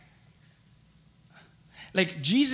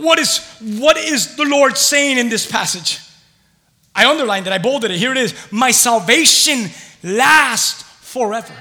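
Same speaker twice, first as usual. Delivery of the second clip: raised, loud preacher's delivery; emphatic preaching; 8 semitones higher, 12 dB louder than usual. Real, not acted. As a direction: drive each phrase home to the congregation.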